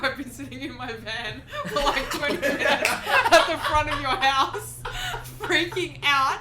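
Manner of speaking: amused retelling of a panicked friend; laughing quote. Retold or imitated. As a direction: giggle while acting out his nerves. Retelling sweaty nervous guest.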